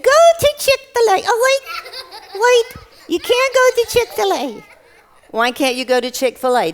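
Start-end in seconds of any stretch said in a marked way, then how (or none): 0.0-4.6 high, loud squeaky puppet voice; high-pitched childlike blurting; puppet character. Acted. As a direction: exclaim like an excitable little puppet. Puppet guesses, then corrects itself.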